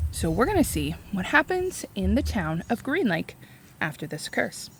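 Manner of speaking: usual speaking voice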